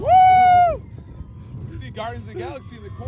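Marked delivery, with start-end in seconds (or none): 0.0-0.8 high loud woo; thrill whoop. Real, not acted. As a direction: cheer on the drop. Riding coaster.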